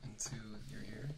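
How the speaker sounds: soft breathy whisper in the ear. Shown as a demonstration. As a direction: lean in and whisper. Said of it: "you can whisper in, into your ear".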